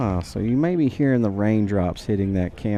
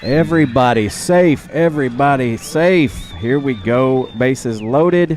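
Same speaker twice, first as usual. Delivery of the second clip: higher, louder thrilled commentary; excited; 4 semitones higher, 5 dB louder, slower than usual. Real, not acted. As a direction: shout it as the bases fill. Bases loaded, announcer thrilled.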